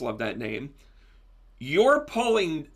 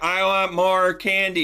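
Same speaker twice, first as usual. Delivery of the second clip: louder and slow, a hammed-up plea; mock kid wanting candy; 5 semitones higher, 6 dB louder, slower than usual. Distracted Aries grabbing at shiny things, mock kid plea. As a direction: beg for candy like a kid who saw something shiny. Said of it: "You see something shiny and you're like".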